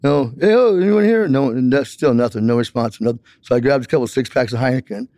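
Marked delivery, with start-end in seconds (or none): 0.0-1.3 higher, half-shouted call into an empty room; retold yell. Calling into the empty store to find the clerk, raised high voice. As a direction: call out for a clerk who isn't there, pitched up. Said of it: "I yelled, I said".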